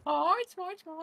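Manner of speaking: very high squeaky greeting; cute anime streamer. Pretend. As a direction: squeak it like a cartoon.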